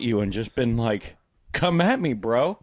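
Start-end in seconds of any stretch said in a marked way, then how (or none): 1.5-2.6 louder, higher mock dare; mock challenge. Telling the attacker how he'd have answered, mock challenge. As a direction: play at calling someone out.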